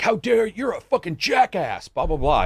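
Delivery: hammed-up indignant celebrity tirade; mock pissy outburst. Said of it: "And they get pissy".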